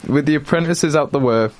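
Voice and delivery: ordinary delivery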